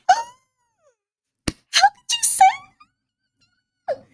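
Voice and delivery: tiny falsetto, close to tears; hammed-up hurt. Hua Lu hearing the insult, mock tearful squeak. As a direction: squeak it out, wounded and very high. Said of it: "on the verge of freaking tears".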